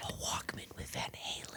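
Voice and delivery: very faint, voiceless whisper; stealthy whisper. Pretend. Co-host adding Van Halen to the prank, whispered. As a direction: join in with a tiny conspiratorial whisper.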